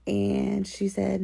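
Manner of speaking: ordinary talk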